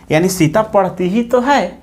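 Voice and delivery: ordinary delivery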